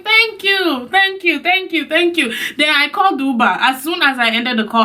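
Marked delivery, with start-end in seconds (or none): 0.0-2.3 mock grateful. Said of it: "I was like".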